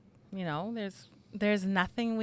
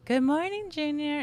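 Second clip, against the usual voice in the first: much higher and louder good morning, Junior; high bright greeting; 8 semitones higher, 4 dB louder than usual. Shown as a demonstration. Mother showing the voice it was not, high and bright. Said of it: "It wasn't like that".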